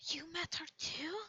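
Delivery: gasped, whispered question; hushed amazed child; breathless whisper. Acted. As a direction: gasp and whisper it in wonder. Child gasps at the news.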